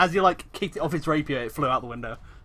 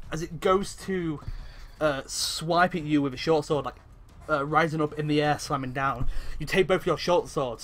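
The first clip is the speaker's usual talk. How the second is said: ordinary talk, nothing marked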